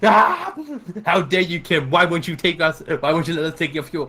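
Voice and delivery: loud hammed-up indignant yelling; mock outrage. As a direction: overplay the betrayal, loud and high.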